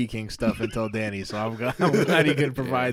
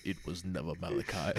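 lightly voiced villain, low and soft; a hint of a menacing villain reveal; 5 semitones lower, 9 dB quieter than usual. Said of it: "a villain voice".

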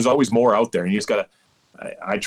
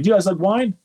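high-pitched imitated host's question; restaurant host impression; 11 semitones higher than usual. Restaurant host offering wine to his guests, high mock-host voice. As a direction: mimic an eager host, voice jumping up high. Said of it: "And the guy's like".

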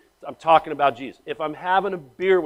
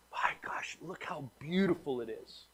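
hushed whisper of wonder; awed whisper; 13 dB quieter than usual. Retold or imitated. Pastor admiring the flowers at the church front, whispering in awe. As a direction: breathe it out in amazement.

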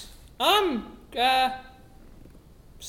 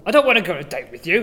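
low Minotaur voice; 11 semitones lower, faster than usual. Acted. Improv Minotaur character voice.

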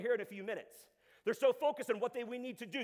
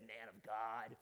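low, soft, sinister voice; imitated demonic growl, very low; demon-possessed person; 10 semitones lower, 8 dB quieter, much slower than usual. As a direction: drop deep and quiet as someone possessed. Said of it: "approached me who was demon-possessed and said".

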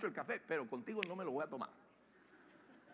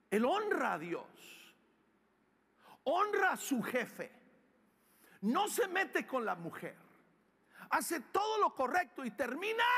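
raised phrases, pausing after each, climbing higher; emphatic preaching; 7 semitones higher, 5 dB louder than usual. Real, not acted. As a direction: hammer each point, building to a high peak. Preaches at full force.

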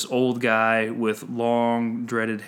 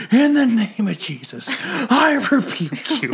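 high, loud mock pastor's rebuke; hammed-up strained shouting, stretching the last words; imitated yelling; 11 semitones higher, 5 dB louder than usual. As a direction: yell it across the street like a zealous pastor. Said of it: "he's yelling across at him".

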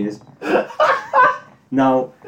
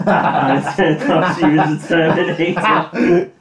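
slow, laughing through words; 3 semitones higher, 4 dB louder, slower than usual. Real, not acted. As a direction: giggle through the line.

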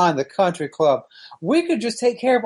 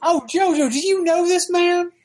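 put-on higher, cranky old-timer; folksy old coot asking; old prospector; 9 semitones higher than usual. [name] voiced, pitched up.

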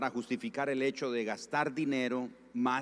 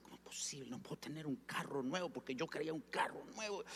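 hushed, fretful muttering to himself; much softer, quick petulant grumbling; angry inner grumble; 3 semitones higher, 11 dB quieter, faster than usual. Retold or imitated. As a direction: act out sulky thoughts under the breath. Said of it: "Yo enojado, pensando".

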